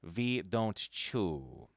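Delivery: put-on slow, deliberate German accent; a lightly voiced German professor proclaiming. A narrator's reading. Professor banning gum in class, mild German accent. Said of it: "he would proclaim in his German accent".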